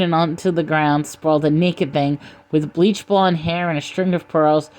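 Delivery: plain reading voice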